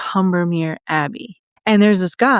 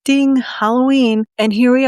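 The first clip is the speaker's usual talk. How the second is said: excited; 5 semitones higher, 3 dB louder than usual. Retold or imitated. Sudden thought of Halloween.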